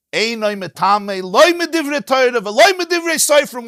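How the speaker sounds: high, loud, ringing declaration; emphatic ruling. Shown as a demonstration. Teacher proclaims the Mishnah's ruling to class, emphatic and ringing.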